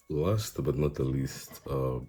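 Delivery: normal talking voice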